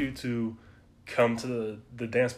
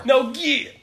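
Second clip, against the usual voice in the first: laughing; 7 dB louder than usual. Retold or imitated. Friend mocks bouncer, laughing.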